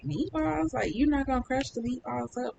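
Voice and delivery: high, incredulous question about the meatballs; surprised disbelief. Retold or imitated. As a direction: sound shocked she left them whole. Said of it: "And I was like".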